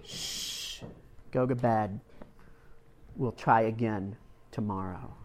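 a soft shh, then quiet tender words; hushed comfort. Retold or imitated. Mother repeating comfort to her boy, hushed and tender. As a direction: hush him gently a second time. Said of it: "She said".

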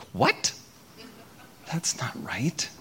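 a breathy whispered objection; whispered protest. Retold or imitated. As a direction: whisper it as a private complaint.

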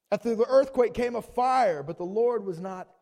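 high, loud preaching read; loud impassioned Bible reading; forceful scripture reading. A narrator's reading. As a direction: proclaim the verse at full volume. Preacher reads scripture at full force.

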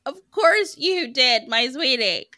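high, slow, doting coo; doting tease. Pretend. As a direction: coo it like to a sweet child. Doting tease, louder and slower.

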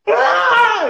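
mock a hit kid's howl of pain; child's wailing cry. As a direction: kid struck by a football cries out, loud wail. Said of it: "and he goes".

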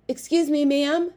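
high, eager and polite; bow and ask politely; polite boy voice. A narrator's reading. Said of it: "bowing to the first guard".

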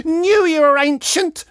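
high, loud cackle into a screech; cackle, then screech the jibe; screeching with laughter. A narrator's reading. Woman convulsed with her own wit, screeching laughter. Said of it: "screeched the woman, convulsed with her own wit".

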